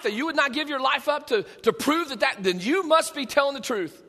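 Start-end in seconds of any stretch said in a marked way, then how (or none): none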